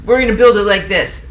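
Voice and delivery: mock confident engineer. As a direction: announce the plan like a cocky gentleman. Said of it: "thoughtful gentlemen of their time".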